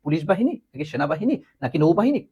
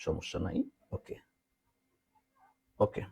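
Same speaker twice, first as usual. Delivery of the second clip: whisper; 12 semitones lower, 15 dB quieter than usual. Real, not acted. Aside to someone nearby.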